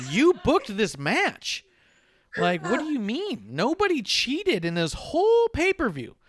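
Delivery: high, slow, loud mock protest; exasperated retort. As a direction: plead the case, hammed up and drawn out. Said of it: "And I was like".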